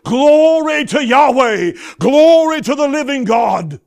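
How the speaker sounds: loud, high, slow shout of praise; triumphant marching praise shout; joyful shout. Acted. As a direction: cry it out to God. Israelites marching out praising God.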